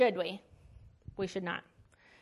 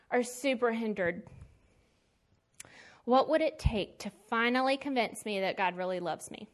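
ordinary delivery

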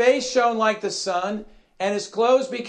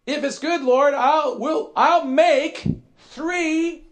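high, stumbling, louder eager offer; 5 semitones higher, 4 dB louder, slower than usual. Acted. Preacher playing Peter offering to build tents, eagerly. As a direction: blurt it out high, stretching the last words. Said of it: "What did Peter say?".

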